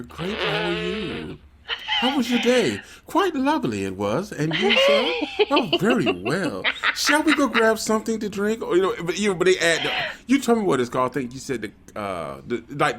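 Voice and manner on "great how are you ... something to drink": higher, hammed-up posh period-drama chatter; laughing mock aristocratic small talk; mock posh TV Brit. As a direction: send up a Downton Abbey conversation. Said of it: "vocals are always very calm, very monotone".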